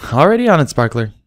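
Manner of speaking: high, quick reply; eager and ready; lightly voiced cheerful mare; Derpy's voice.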